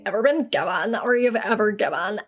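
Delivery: put-on Valley Girl accent. Reading a fan's advice question, Valley Girl accent. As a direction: read it as a mock Valley Girl. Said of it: "I can do Valley Girl".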